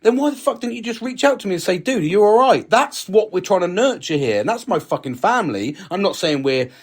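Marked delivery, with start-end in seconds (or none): 0.0-2.7 higher, exasperated retelling; mock-frustrated retort to a friend; retold exasperated question. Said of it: "And I said".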